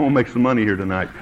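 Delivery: ordinary talk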